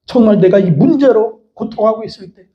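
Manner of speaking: usual speaking voice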